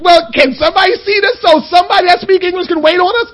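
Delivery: mock desperate plea for a table; retold exasperated plea. Tourist pleading with Paris restaurant staff, half-shouted.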